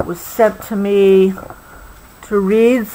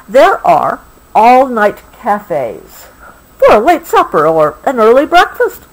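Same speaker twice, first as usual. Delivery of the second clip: bright storybook reading, a little louder; story reading; 4 semitones higher, 4 dB louder than usual. A narrator's reading. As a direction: read it cheerfully to a child. Reading book text.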